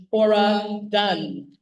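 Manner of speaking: drawn-out, loud name announcement; announcer call. Real, not acted. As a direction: call the name out slowly, like an emcee. Introducing speaker, calling name out.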